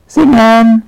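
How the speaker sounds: high, loud command; girl cueing the little witches to sing; calling out. Acted. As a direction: shout the cue to start singing. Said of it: "ruft Fuchsia".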